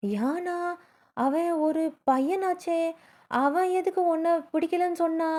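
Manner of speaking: retold grandpa's slow, baffled question; puzzled. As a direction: draw it out high, as if it makes no sense. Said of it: "டக்குன்னு புரியாம".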